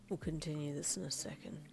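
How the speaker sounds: hushed, low whisper. A narrator's reading. Husband to wife pulling into driveway, low whisper. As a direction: keep it secret, barely voiced. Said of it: "he said lowly".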